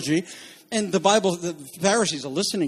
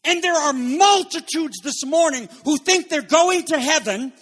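fiery preaching; 8 semitones higher than usual. Real, not acted. Preacher rails at the congregation about easy believism, fiery preaching. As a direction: preach it hard at the crowd, climbing and shouting.

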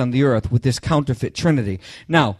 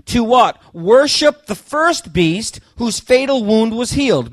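higher, slow dramatic verse reading; scripture reading; 5 semitones higher, slower than usual. Acted. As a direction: proclaim the verse slowly and pointedly. Preacher reads scripture aloud.

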